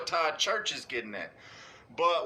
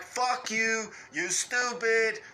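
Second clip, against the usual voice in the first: higher, slow blunt mock snap; higher, slow mock anger; blunt insult; 6 semitones higher, slower than usual. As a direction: spit it out bluntly, slower. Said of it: "He tell it to you like".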